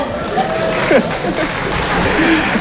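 wheezing airy laugh; breathy laugh. Real, not acted. Comedian laughing silently at his own joke, airy. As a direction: laugh under your breath.

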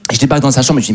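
normal talking voice

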